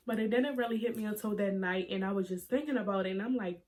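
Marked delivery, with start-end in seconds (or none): none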